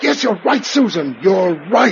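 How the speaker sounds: high, loud, with a laugh of relief; a delighted laugh breaking through the words; joyful relief. Acted. Finding the lost woman's signal.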